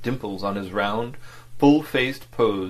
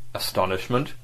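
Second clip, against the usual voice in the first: plain reading voice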